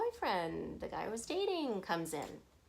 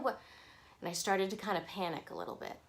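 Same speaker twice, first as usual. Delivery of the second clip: usual speaking voice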